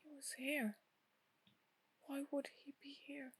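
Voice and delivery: shaken murmur. Acted. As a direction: barely get the words out. Shaken character asking after the vision.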